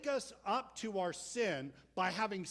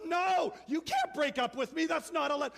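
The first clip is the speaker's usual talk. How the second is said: much higher, loud panicked yell; freaking out shout; 9 semitones higher, 7 dB louder than usual. Retold or imitated. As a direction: shout her down in outrage. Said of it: "she freaks out".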